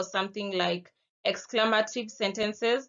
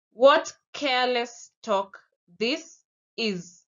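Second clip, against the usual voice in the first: angry; 3 semitones higher, 4 dB louder than usual. Shown as a demonstration. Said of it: "you're angry".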